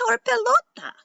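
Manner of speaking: high, loud cry of surprise; excited shout. A narrator's reading. Girl spots her lost ball flying back, excited shout. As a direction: shout it out, pitched right up. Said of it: "shouted Carmen".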